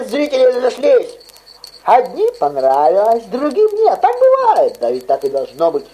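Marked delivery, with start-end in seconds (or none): none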